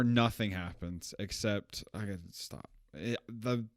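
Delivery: ordinary talk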